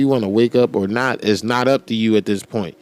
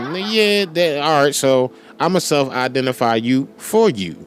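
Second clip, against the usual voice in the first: ordinary delivery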